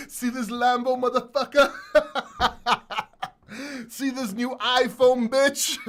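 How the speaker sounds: loud, laughing brag; gloating rich friend. Retold or imitated. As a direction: rub it in their face while laughing. Gloating rich crypto holder.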